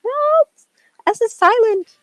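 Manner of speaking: high-pitched mock correcting voice; mock correction. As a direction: squeak it out like a pained pedant. Acting out correcting a mispronouncer.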